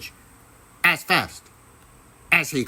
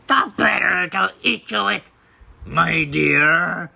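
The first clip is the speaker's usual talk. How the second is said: roaring wolf; 5 semitones higher than usual. A narrator's reading. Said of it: "roared the wolf".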